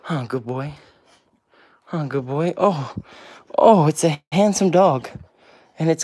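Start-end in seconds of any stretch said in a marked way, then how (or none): none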